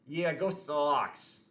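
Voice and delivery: slow, drawn-out Boston "go Sox"; a self-mocking try at a Boston accent. Shown as a demonstration. Streamer doing a Boston accent for chat, slow and drawn out. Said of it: "my best impression of a Boston accent".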